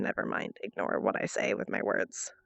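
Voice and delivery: muttered whisper under breath; whispered aside. Real, not acted. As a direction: drop to a whisper. Trailing off into whisper.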